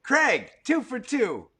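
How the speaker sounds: loud, high, drawn-out cheer; announcer-like triumphant call; excited congratulation. Real, not acted. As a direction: hail the winner slowly and loudly. Celebrating listener's second correct answer.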